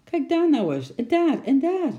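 put-on high, loud eager boy pointing; excited pointing. Boy shows his uncle the money, excited.

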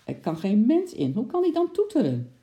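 rising, high bewildered question; can't make sense of it, worked up; puzzled and agitated. Acted. Wife wondering how the locked car honks, high agitated.

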